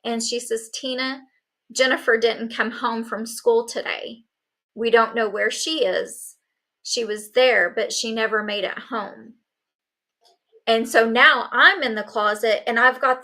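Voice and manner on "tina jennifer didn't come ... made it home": usual speaking voice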